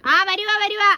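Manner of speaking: high, loud shout of coming, coming; calling back. Real, not acted. Answering someone calling from the house, shouted high. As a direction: call back across the yard, high and loud.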